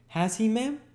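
put-on very high, hopeful boy; delighted cry as Oliver. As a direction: ask with sudden pleasure as a little boy. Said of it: "cried Oliver, his face brightening with pleasure".

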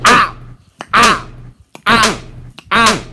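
loud pained yelps; sharp high ow from a slap; just got slapped. Real, not acted. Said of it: "you slapped me too hard".

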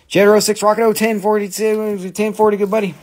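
high, loud trucker-radio patter; CB radio call. Pretend. Plays CB radio call to buyer.

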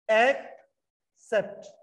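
"एक्सेप्ट" stretched out very slowly as ek-sept with a clear k; slow correct pronunciation. Shown as a demonstration. Teacher repeats "एक्सेप्ट" very slowly so the class hears the k. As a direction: drag out each sound of "एक्सेप्ट", k first. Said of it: "पहले सी पे आपको क pronounce करना".

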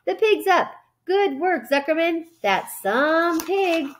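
light, high imitation of a cheering crowd; crowd yelling. A narrator's reading. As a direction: call out like spectators cheering, stretching the last words. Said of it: "yelled the crowd".

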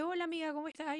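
saludo agudo y suave de amiga. Retold or imitated. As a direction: imita a una amiga saludando con voz aguda. Amiga saludando para pedir un favor. (saludo meloso imitado)

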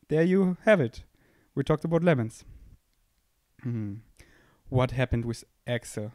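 ordinary talk

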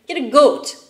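mock-emphatic order to get a goat; hammed-up doctor prescribing a goat. Doctor voiced in retold story.

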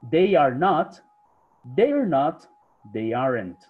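teacher modelling each phrase slowly and clearly; slow drill voice. Shown as a demonstration. Teacher leading the class in a slow pronunciation drill. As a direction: drill the contractions clearly, one by one. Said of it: "Y practicamos nuestra pronunciación".

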